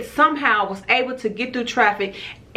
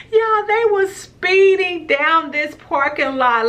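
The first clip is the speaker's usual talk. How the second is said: excited; 9 semitones higher than usual. Real, not acted. Excited retelling of the chase.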